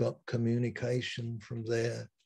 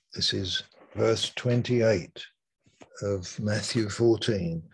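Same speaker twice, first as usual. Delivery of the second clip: usual speaking voice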